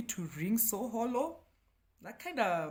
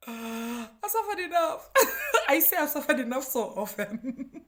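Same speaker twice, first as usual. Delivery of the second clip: laughing; 8 semitones higher, 7 dB louder than usual. Real, not acted. Joking complaint, laughing.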